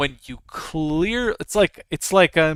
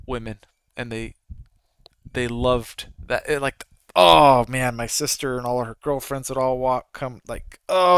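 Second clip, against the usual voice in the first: ordinary talk, nothing marked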